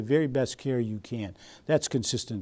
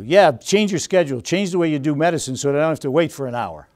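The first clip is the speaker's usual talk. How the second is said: unreasonable demand; 4 semitones higher, 6 dB louder than usual. Retold or imitated. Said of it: "They may say something very unreasonable".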